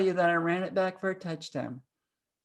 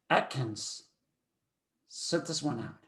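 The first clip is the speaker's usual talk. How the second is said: low, quiet instruction; subdued, low-pitched coach voice; restrained coach; 7 semitones lower, 7 dB quieter than usual. Retold or imitated. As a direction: bench a player softly, without yelling. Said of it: "Big Al never yelled at me".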